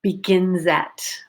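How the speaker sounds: begins at run together as one; linked. Shown as a demonstration. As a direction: link the s of begins into at. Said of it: "We linked that".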